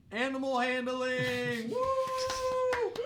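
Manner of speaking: high, loud whoop of delight; excited cheer. Real, not acted. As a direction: cheer it out like a fan. Cheering a skill tier ranking.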